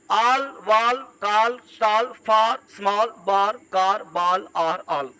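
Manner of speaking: all, wall, call as a measured list with long AA; open A sound. Shown as a demonstration. Teacher repeating the A-as-AA words, drawn-out vowel drill. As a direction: drill all, far, small, drawing out each open vowel. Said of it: "यह sound जो है".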